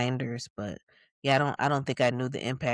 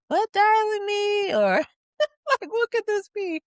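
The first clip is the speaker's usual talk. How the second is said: high-pitched, laughing puzzlement; puzzled; 6 dB louder than usual. Retold or imitated. Speaker puzzling over grandmother's late call, high amused puzzlement. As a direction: wonder aloud in a high, amused squeal.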